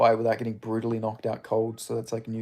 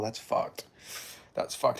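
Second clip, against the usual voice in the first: usual speaking voice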